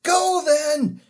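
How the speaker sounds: loud, high urgent shout; Diggory shouting to Polly while holding on. A narrator's reading. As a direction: yell it to Polly. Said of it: "shouting to Polly".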